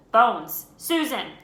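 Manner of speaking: loud roll call across the hall; calling out a name. A narrator's reading. Teacher calling out names.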